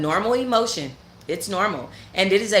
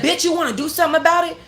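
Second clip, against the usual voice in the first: higher, fast mock threat; imitated threat; 10 semitones higher, 3 dB louder, faster than usual. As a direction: rattle off the girl's dare.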